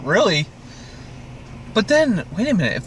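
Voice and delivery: usual speaking voice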